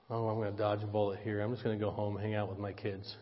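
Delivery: low, quick thinking to himself; quick undertone, as if privately plotting an escape; private thought. Retold or imitated. Pastor to himself about dodging the visit, low private thought.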